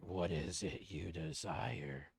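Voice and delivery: low, slow otherworldly voice; creepy paw voice. Acted. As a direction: speak like a sinister cursed object.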